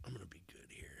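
soft whisper, half to yourself; whispered to self. Real, not acted. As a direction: whisper it under your breath. Muttering to self while searching.

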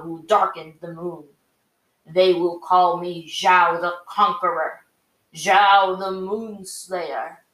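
slow, grand proclamation of a conquering general; a lightly voiced megalomaniac admiral, drawn out; gloating villain. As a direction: savour each title as he crowns himself. Villain proclaims his glory.

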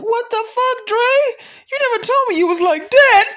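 high-pitched shocked falsetto outburst; mock disbelief. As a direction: squeal it like you just found out your hero lied to you. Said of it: "I was like".

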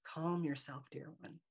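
sedating, gentle reassurance; soothing spirit voice. Retold or imitated. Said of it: "she says with her mind to my mind".

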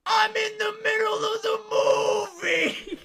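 very high, loud mock wail; retold outraged wail. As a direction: wail it like a man whose film was just ruined. Stall man confronts the kids.

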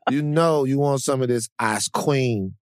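ordinary talk

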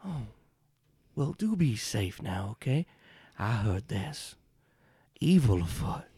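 a sweet-talking charmer greeting strangers; genteel stranger voice. Acted. GM stranger warns.

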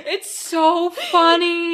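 slow, high, wheezing through laughter; real helpless laugh. Real, not acted. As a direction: barely get the words out for laughing.

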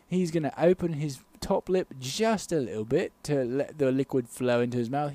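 hushed British expert analysis; British sports commentator. Acted. Analysing Marco's sipping technique, as British commentator. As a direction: explain the technique as a measured British pundit. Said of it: "I'll commentate the whole bit".

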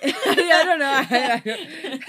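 loud, high, words stretched by laughter; real laughing fit. Real, not acted. Reader answering co-host's question, helpless laughter. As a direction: crack up while trying to answer.

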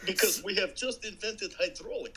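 high-pitched mock Russian accent. Co-host finishing the Soviet guy's thought, bad Russian accent. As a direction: join in with a hammed-up Russian accent. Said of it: "your horrible Russian accent is as good as mine".

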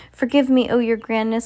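high, loud begging; miserable cry. A narrator's reading. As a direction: implore the leader for forgiveness. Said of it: "cried the miserable culprit".

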